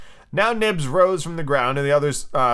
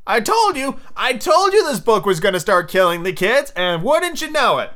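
high-pitched, worked-up vindication; animated told-you-so rant; I-told-you-so outburst; 7 semitones higher than usual. Real, not acted. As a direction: crow that you predicted it. Reader vindicated over his prediction.

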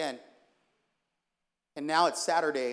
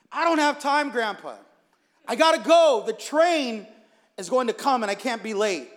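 high, loud urgent protest; frantic protest; 5 semitones higher, 7 dB louder than usual. Acted. Grandson refusing coffee with grandpa, frantic. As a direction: push back in a raised, pressed voice.

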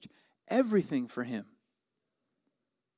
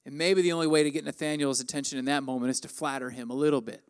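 ordinary delivery

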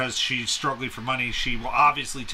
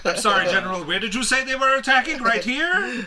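high, sweetly innocent fake spy voice; mock innocent spy; 10 semitones higher, 6 dB louder than usual. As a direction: play dumb in a high sweet voice. Acting out the spy scene.

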